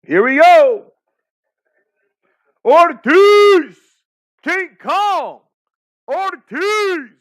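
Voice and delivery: very high, loud chant for a fighter; drawn-out, high-pitched cheering; excited shouting. Real, not acted. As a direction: holler the names like a wild fan.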